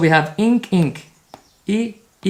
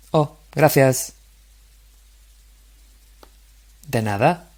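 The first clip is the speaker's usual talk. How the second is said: model dialogue reading; 3 dB louder than usual. Shown as a demonstration. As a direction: read each line out clearly for repetition. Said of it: "try to imitate my same intonation".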